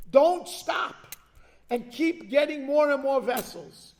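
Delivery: "Don't stop" rising and loud; commanding call. Acted. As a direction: drive the prophet's command home. Prophet commands the widow.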